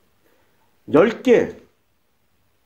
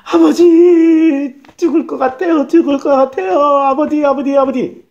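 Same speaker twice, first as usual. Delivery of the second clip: anguished crying out; 5 semitones higher, 4 dB louder than usual. Acted. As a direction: cry out in desperate suffering, voice raised. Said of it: "이러고 부르짖었을 거 아니에요". (고통스럽게 부르짖는 소리)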